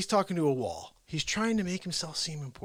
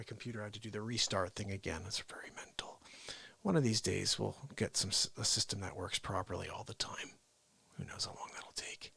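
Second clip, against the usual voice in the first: whisper; 7 semitones lower, 7 dB quieter than usual. Real, not acted. Aside while restarting.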